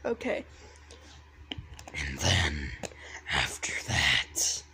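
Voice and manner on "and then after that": creepy whisper. Acted. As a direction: ease in with a hush. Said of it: "Doing that voice".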